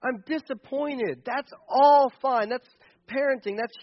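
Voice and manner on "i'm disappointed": sad disappointed parent. Pretend. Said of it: "we can be angry like".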